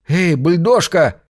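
calling out from above, drawn out; shouting. A narrator's reading. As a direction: shout down to the ground. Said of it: "закричал он во всё горло".

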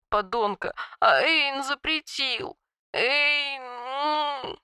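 high, soft sobbing on the inhale; talking while inhaling. A narrator's reading. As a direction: sob the words while breathing in. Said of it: "рыдая, проговорила на вдохе".

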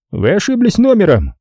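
high-pitched official brush-off; sergeant's voice. A narrator's reading. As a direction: dismiss the caller in a high phone-cop voice. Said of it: "сообщил он мне".

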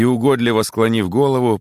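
ordinary delivery

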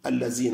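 اللذين with z in place of dh; southern Algerian pronunciation. Shown as a demonstration. Teacher showing a regional habit, dhal turned to z. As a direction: say اللذين as allazina. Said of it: "الذال يحولونها إلى زاي".

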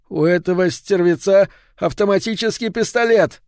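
high-pitched, agitated cry of realisation; alarmed exclamation. A narrator's reading. Will realising the killer holds an automatic pistol, alarmed cry.

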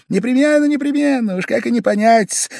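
high, loud, over-eager agreement; extreme readiness. A narrator's reading. Guest agreeing before host finishes, eager and high. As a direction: jump in eagerly at high pitch. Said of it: "с чрезвычайной готовностью подхватил".

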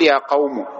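"قوم" ending in u; damma ending. Shown as a demonstration. Teacher showing the weak sixth form, "قوم" with damma. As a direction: say "قوم" as qawmu. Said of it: "فتضم".

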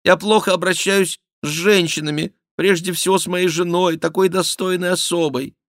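high, loud repentant whine; put-on contrite confession; penitent mock king. A narrator's reading. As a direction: repent loudly on the king's behalf. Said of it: "каялся Шико от имени короля".

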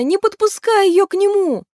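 high, urgent command; exclamation. A narrator's reading. Maeve telling river horse to guard him, urgent shout. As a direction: cry the order out in alarm. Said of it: "воскликнула она".

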